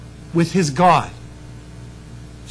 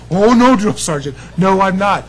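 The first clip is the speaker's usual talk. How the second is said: acted-out cheeky protest, raised voice; retold protest; 4 dB louder than usual. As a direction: blurt it back at the drill sergeant. Retold defiance of drill sergeant.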